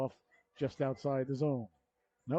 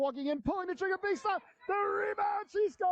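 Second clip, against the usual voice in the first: much higher, shouted goal call. Real, not acted. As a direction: erupt as she scores.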